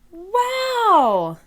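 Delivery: high amazed cry; excited wow. Acted. Peppa seeing her huge pumpkin, crying out in high delight. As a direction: gasp it out with delight. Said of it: "cries Peppa".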